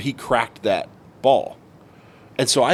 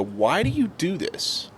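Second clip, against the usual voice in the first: exasperated plea; 7 semitones higher, 3 dB quieter, slower than usual. Retold or imitated. Pleading with bullies in retold scene.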